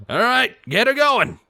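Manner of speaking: high-pitched stallion voice calling across to the booth. Acted. The stallion calling to the operator to start the wheel, a high call. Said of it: "he called to the pony".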